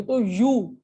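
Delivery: ordinary talk, nothing marked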